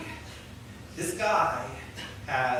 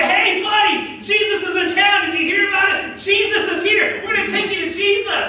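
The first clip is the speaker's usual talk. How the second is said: high, loud, bursting with news; excited shout; 9 semitones higher, 4 dB louder than usual. Retold or imitated. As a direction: burst in yelling the good news. Friends barging in, shouting excitedly.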